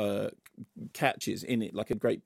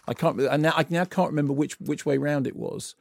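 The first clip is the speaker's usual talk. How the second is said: ordinary delivery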